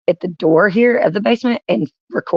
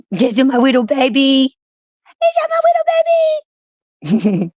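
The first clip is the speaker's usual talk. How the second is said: put-on baby talk; 7 semitones higher than usual. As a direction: gush at a tiny pet, pitch soaring. Talking baby talk to dog.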